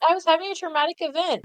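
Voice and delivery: high, rushed mock outrage; indignant protest. Steven insisting to Garnet it was trauma, indignant. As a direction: blurt it fast and high, deeply affronted.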